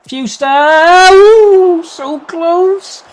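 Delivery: very high, loud, drawn-out ooh; excited near miss. Real, not acted. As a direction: cry out as the ball just misses. Chance narrowly missed.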